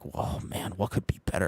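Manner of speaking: mock-amazed whisper; hushed awe. Streamer imitates a stunned diner in a hushed whisper.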